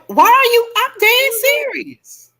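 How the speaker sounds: high-pitched, drawn-out indignant protest; mock-indignant, voice pitched right up; retold protest. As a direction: act out insisting you are serious. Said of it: "I be dead fucking serious".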